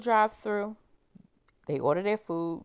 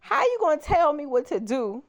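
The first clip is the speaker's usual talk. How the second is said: high, loud outraged squeal of disbelief; indignant disbelief; 9 semitones higher, 4 dB louder than usual. Retold or imitated. Telling a friend how she reacted to the rude order, high indignant squeal. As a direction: shriek it like you can't believe the nerve. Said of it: "And I'm like".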